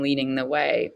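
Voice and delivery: usual speaking voice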